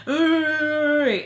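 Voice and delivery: long, loud imitated groan; drawn-out high noise of disapproval; older man's grunt. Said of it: "older gentleman is like".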